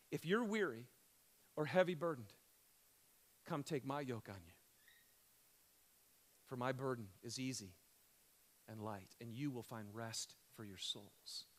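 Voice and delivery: hushed, gentle reassurance; tender scripture quote. Acted. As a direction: ease the words out softly, comforting. Jesus' words quoted tenderly.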